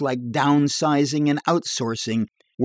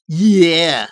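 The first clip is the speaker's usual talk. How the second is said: higher, louder bar yarn voice; tall-tale barfly; 5 semitones higher, 9 dB louder than usual. Acted. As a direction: spin a dirty joke in a bar. Edgar voiced.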